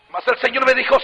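usual speaking voice

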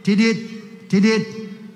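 steady beeping, tidit, tidit; monitor beep imitation. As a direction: mimic a heart monitor's even beep. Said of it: "el monitorcito estaba tidit".

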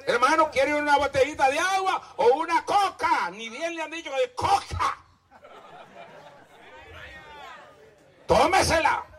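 sarcastic preaching. Real, not acted. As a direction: taunt the listener. Mocking offer, sarcastic dare.

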